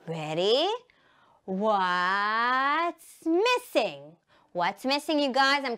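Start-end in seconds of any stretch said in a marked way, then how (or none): 1.4-4.2 very slow, stretched question; suspense-building quizmaster call; drawn-out game call. Shown as a demonstration. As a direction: stretch "what's" right out. Game catchphrase called to children.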